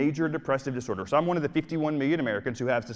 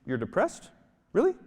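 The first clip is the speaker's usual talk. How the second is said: normal talking voice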